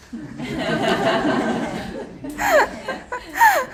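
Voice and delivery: bright burst of laughter; laughing. Real, not acted. Laughs at age joke.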